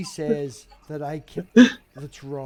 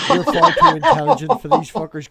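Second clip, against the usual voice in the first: laughing; 9 semitones higher, 8 dB louder than usual. Real, not acted. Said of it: "laughing repeat".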